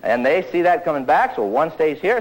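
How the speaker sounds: ordinary delivery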